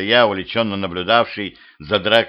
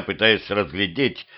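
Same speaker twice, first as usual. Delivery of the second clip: ordinary delivery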